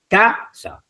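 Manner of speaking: CAsa with the first syllable held long and high; drawn-out model word casa; stress on first syllable. Shown as a demonstration. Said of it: "En la penúltima".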